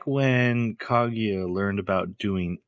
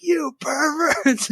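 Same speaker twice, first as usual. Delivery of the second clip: very high, drawn-out shocked shriek; scandalized accusation; much slower than usual. Retold or imitated. Retelling Mayuki calling them perverts in a high shocked wail. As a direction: pitch way up, slow and appalled. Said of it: "is like".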